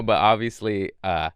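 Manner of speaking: ordinary talk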